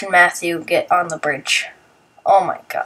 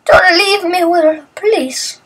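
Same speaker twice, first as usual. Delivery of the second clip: whiny plea; 9 semitones higher than usual. Retold or imitated. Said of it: "And Matthew's like".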